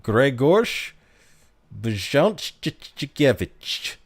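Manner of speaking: Grzegorz Brzęczykiewicz, slowly through the Polish consonant clusters; Polish pronunciation. Shown as a demonstration. Streamer saying a Polish movie name on request, careful Polish pronunciation. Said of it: "how he pronounced it in the movie".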